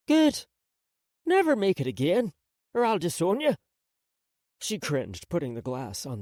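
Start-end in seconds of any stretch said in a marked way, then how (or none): 0.1-3.5 grandmother voice. A narrator's reading. Grandmother scolds.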